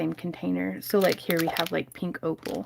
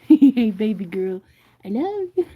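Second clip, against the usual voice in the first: pet talk; 7 dB louder than usual. Real, not acted. Cooing to her cat.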